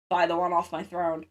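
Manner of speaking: normal talking voice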